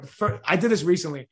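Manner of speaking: ordinary talk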